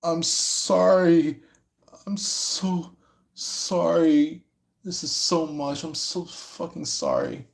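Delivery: high, slow, tearful whispered sorry; imitating someone sobbing out an apology; hammed-up weeping, voice breaking; heavy sobbing apology. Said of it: "they're crying so heavily".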